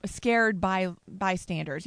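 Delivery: ordinary delivery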